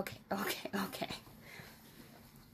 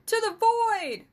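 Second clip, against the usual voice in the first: crowd yelling; 13 semitones higher, 9 dB louder than usual. Acted. Crowd shouting defiance at the balcony, furious yell. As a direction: roar it as the angry mob. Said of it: "more people yelled".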